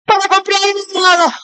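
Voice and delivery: very high, hugely drawn-out wail of supplication; hammed-up pious cry, held notes; daughter's mock prayer. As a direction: parody a child praying grandiosely to dad. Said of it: "Si mi hija viene un día así".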